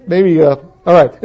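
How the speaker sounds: ordinary talk, nothing marked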